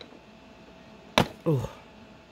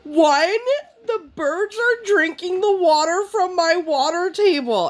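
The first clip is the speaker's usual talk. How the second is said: put-on high, slow whimpering little-girl voice; weepy toddler. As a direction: mimic her teary toddler voice. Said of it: "And she's like".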